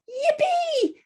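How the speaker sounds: high, squealing storybook delight; joyful high-pitched character cheer; joy and excitement. Acted. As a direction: burst out with gleeful excitement. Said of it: "with a feeling of joy and excitement".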